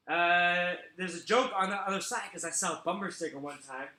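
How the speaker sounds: usual speaking voice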